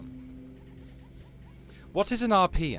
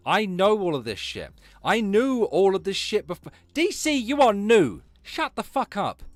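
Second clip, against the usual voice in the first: loud, raised-pitch angry outburst; real anger; 5 semitones higher, 7 dB louder than usual. Real, not acted. Streamer telling DC she is new and uninformed, angry. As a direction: shout them down, hammering each phrase. Angry quarrel over inside knowledge.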